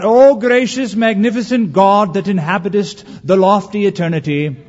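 high, loud, hammed-up pious prayer; pompous mock-reverent address to God; mock grand prayer. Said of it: "They haven't learned how to do it yet".

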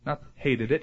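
ordinary talk

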